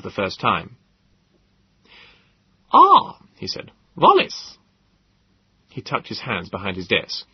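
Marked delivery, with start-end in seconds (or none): 2.7-3.2 sudden high exclamation of recognition; startled high, loud greeting; Gödel's voice. Acted. Odd professor suddenly notices his visitors, high and loud. 4.0-4.6 sudden high exclamation of recognition; startled high, loud greeting; Gödel's voice. Acted. Odd professor suddenly notices his visitors, high and loud.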